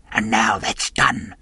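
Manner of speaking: sinister slow whisper. A narrator's reading. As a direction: blind man whispering to the captain, slow and sinister. Blind man's sinister closing remark.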